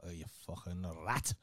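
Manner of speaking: RAT with a rolled, trilled R; low mock jibe, rolling the R in RAT. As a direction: roll the R hard on "rat". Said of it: "proper roll the R's on as well".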